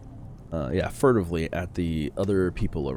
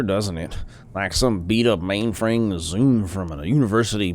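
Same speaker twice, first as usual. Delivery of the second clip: put-on old man voice; 3 semitones higher, 4 dB louder than usual. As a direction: ramble like an excitable old tinkerer. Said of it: "That's the voice I'm going with".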